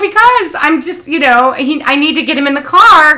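high, loud eager explanation; eager pleading. Retold or imitated. Mother asking the dog's owner for help, high and eager. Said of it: "And I go".

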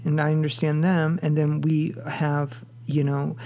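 usual speaking voice

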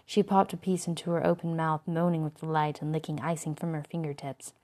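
ordinary delivery, nothing marked

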